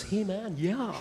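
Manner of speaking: breezy hey from an overkeen store clerk; boy band shop assistant bouncing over to greet a customer, mock perky; bouncy shop boy. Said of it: "they bounce".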